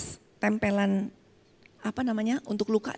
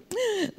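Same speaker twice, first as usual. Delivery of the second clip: high laugh; 11 semitones higher than usual. Real, not acted. Laugh after joke to audience.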